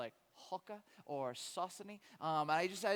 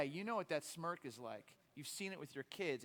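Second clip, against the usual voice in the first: ordinary talk, nothing marked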